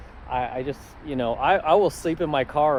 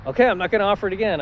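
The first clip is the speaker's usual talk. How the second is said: higher, louder, fast father giving up; exasperated dad; 7 semitones higher, 5 dB louder, faster than usual. Retold or imitated. Dad giving up on his stubborn son, higher, louder and fast. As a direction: snap it off as a final offer. Dad voiced, final offer.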